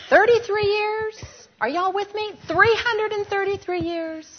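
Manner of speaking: rising, loud emphatic build; preaching climax. Real, not acted. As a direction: hammer home the years.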